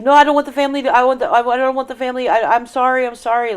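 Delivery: high, loud child's pleading, re-enacted; frantic apology. Frightened child apologizing to upset mom, frantic. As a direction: plead and apologize in a rush of panic. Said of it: "Like, I'm already apologizing".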